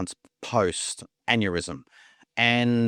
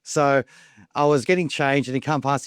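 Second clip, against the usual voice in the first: ordinary talk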